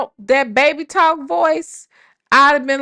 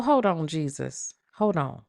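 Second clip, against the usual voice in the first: low aside; 9 semitones lower, 10 dB quieter than usual. Real, not acted. Confused aside to Nesto.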